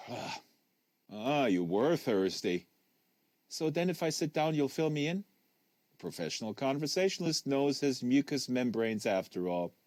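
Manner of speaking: plain reading voice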